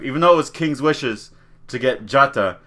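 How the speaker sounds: ordinary delivery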